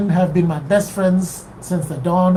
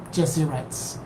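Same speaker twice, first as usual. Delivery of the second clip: ordinary delivery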